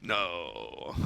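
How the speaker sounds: deep, soft vampire no; a low, hushed Transylvanian no. Retold or imitated. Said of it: "In that voice exactly".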